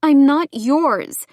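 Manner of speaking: slow, high, loud refusal; defiant. A narrator's reading. Woman stands up to her ex, defiant. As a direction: stand up to him, slow and emphatic.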